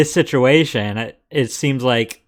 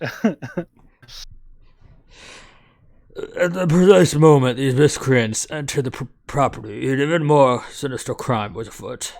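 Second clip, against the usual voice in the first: measured narrator voice for the game text; story narration. Acted. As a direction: set the scene like a mystery narrator.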